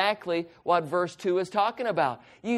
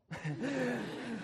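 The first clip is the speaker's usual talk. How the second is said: soft chuckle; 5 semitones higher, 12 dB quieter than usual. Real, not acted. Laugh after refusing candy.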